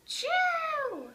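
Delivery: loud, high bursting sneeze; full-force cartoon achoo; big sneeze. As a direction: let the sneeze explode out. Gerald finally sneezes.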